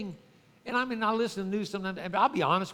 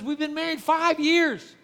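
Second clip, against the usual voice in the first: raised, louder, dismissive get over it; impatient husband; 7 semitones higher, 7 dB louder than usual. Retold or imitated. Husband loudly telling his wife to get over her history, impatient. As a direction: brush her past aside, loud and impatient. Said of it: "the guy looks at her and says".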